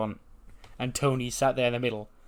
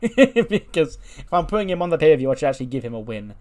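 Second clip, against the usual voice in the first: real laugh breaking into raised, louder speech; amused laughing; 5 semitones higher, 7 dB louder than usual. Real, not acted. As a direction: laugh while justifying your choice. Amused at booking his own win.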